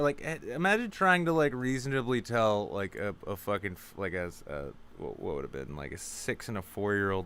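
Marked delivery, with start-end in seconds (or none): none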